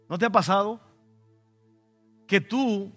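ordinary delivery

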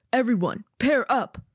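high barked command; barking gym coach. Acted. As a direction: call the class to pair up. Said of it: "issues the command".

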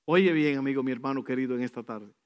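ordinary talk, nothing marked

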